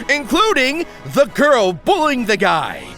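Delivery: normal talking voice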